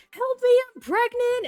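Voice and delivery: high, loud hammed-up plea for help; mock freak-out. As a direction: imitate a panicking movie character, voice shooting up. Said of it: "freaking out. She's like".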